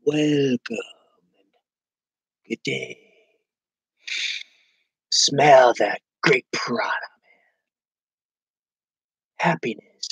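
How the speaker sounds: slow, hushed, whispered sales voice; dreamy guru imitation. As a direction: breathe it out like a blissed-out salesman. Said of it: "and he's like".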